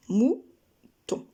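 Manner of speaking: Mouton drawn out as two beats; syllable demo. Shown as a demonstration. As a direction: trace the two arcs of Mouton. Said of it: "deux syllabes, deux arcs de cercle".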